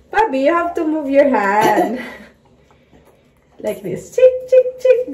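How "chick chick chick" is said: high loud chirpy calling; playful chick call. Real, not acted. Mother playful sound.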